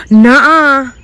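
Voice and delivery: the realisation hits all at once; gasped disbelief. Real, not acted. Disbelief at finding potatoes.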